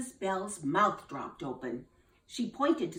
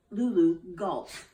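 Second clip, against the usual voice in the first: plain reading voice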